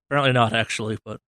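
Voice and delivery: usual speaking voice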